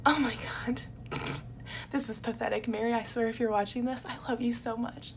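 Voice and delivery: soft, choked-up near-whisper; fighting back tears while gushing; getting teary. Real, not acted. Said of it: "makes me wanna cry".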